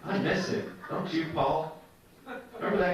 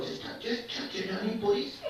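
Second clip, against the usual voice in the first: high, small squirrel voice begging; high-pitched wheedling plea; pleading beach squirrel; 9 semitones higher, 3 dB quieter than usual. Acted. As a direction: beg like a desperate little animal, pitched way up. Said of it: "A little beach squirrel".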